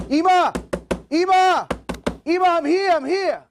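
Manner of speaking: loud, high cry for mother; calling out. Retold or imitated. Son arriving to find his mother, urgent loud calling. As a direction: shout to be heard through the door.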